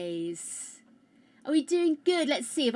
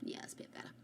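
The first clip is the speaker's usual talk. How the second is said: soft whispered aside; 18 dB quieter, much faster than usual. Real, not acted. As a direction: whisper it to herself.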